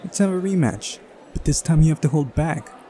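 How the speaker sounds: put-on high girl's voice pushing for another go; demanding girl character. Voicing Uliana demanding a rematch.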